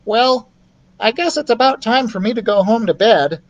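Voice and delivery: high little mouse voice; shy mouse making an excuse; high mouse voice, backing off. Acted. Ralph voiced.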